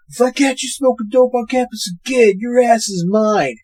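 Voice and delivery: high-pitched imitated threat; put-on threatening officer voice; mock tough cop. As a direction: play the blustering campus cop, hammed up. Retells cop threatening students.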